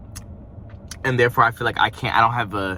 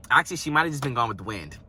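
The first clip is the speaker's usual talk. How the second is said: ordinary talk, nothing marked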